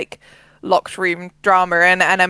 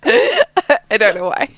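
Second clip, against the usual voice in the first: laughing; 7 semitones higher, 4 dB louder, much slower than usual. Real, not acted. Still laughing after the joke.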